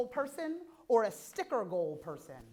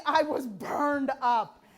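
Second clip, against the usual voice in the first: ordinary talk, nothing marked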